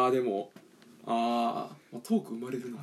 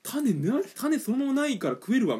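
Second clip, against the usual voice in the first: 食レポ; 6 semitones higher than usual. Pretend. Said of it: "これ食レポの勉強にもなるからね".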